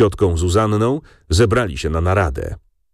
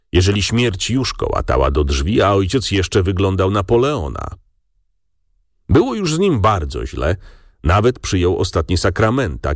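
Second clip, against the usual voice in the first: plain reading voice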